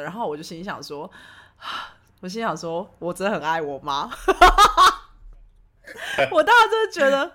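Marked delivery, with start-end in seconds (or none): 4.2-5.0 burst of laughter. Real, not acted. Laughing at herself.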